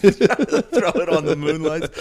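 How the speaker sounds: slow, high, laughing through the words; laughing mock quote. Friend echoing the Chardonnay story, laughing. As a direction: repeat his line while cracking up. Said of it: "I was like".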